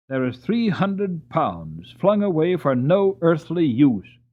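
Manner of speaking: lightly voiced irritated old earl; annoyed Earl. Annoyed Earl inspects ruined horses.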